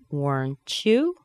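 slow "Weren't you", rising at the end; rising intonation. Shown as a demonstration. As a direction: lift "you" as a question. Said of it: "Going up in intonation".

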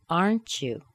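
"Aren't you" falling at the end; falling intonation. Shown as a demonstration. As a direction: stress "Aren't", drop on "you". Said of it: "let the intonation fall down".

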